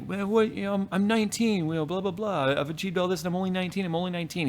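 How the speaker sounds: high, mocking imitation of a cocky nineteen-year-old; mocking young rapper. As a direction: play him up as a smug kid bragging. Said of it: "and he was like".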